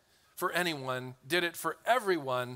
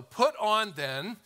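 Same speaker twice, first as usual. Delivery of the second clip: slow, deliberate reading; 4 semitones higher, slower than usual. Shown as a demonstration. Preacher showing the church how to read slowly. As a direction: read it slowly, higher, lingering on put. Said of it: "Let's read these words slow".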